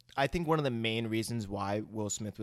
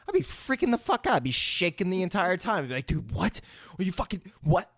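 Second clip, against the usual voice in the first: higher-pitched, mock freak-out; imagined hysteria; 8 semitones higher than usual. Imagining himself facing an alien fight, mock panic. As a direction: act out panicking at the news. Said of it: "I would be going in hysteria".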